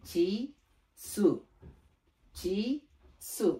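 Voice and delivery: চি with ch, সু with ts; correct "chi, tsu" reading. Shown as a demonstration. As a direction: say চি, সু as "chee, tsoo", separately. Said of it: "এটা হচ্ছে সঠিক".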